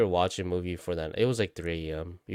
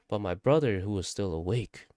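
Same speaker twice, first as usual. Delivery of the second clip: usual speaking voice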